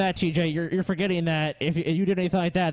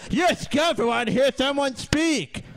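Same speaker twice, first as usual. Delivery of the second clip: mock protester voice; 6 semitones higher than usual. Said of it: "in her nice little cozy life".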